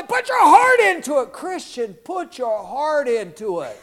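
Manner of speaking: high, loud coaching yell, fading off; yelled encouragement. Retold or imitated. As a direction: shout it like a spotter pushing him. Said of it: "They would yell at me".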